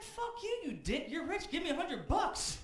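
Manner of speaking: high, loud indignant yell; mock outrage. Comic to Caruso, high and furious. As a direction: shout it in mock fury. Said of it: "I'm like".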